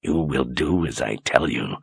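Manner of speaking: low, quiet and clenched; through gritted teeth. Acted. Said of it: "through gritted teeth".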